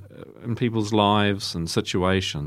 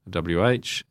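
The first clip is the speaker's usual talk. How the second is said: usual speaking voice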